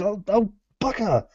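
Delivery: high, flustered, caught out; flustered panic. Acted. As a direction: squeak out mock panic. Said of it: "but then you said".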